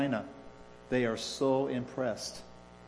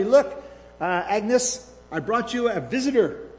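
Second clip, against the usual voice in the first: higher-pitched, cheerful nurse announcement; merry call; 9 semitones higher, 7 dB louder than usual. A narrator's reading. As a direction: call it out merrily, lightly acted. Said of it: "The nurse called out merrily".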